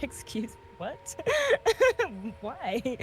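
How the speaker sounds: mock bewilderment dissolving into laughter; laughing confusion. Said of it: "He's like".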